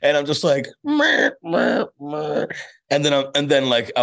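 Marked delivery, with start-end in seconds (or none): 0.8-2.5 high pinched bleats of embarrassment; mock helpless little noises; embarrassed meh noises. Comedian retelling his embarrassment as the actor leaves, mock bleating.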